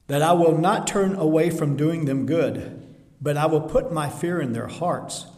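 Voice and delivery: loud, ringing preaching of the verse; forceful scripture reading. Acted. Preacher booming God's promise to the church. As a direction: proclaim it to the congregation.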